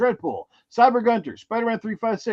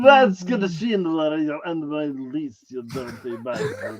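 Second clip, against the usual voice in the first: slow, hammed-up Dracula-meets-Arnold accent; mangled Dracula-Arnold accent; 3 semitones lower, 5 dB louder, slower than usual. Host threatening a viewer in a bad Dracula-Arnold accent. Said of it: "I don't even know what kind of accent I am trying to do here".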